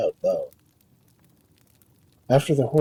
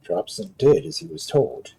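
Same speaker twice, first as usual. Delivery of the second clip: usual speaking voice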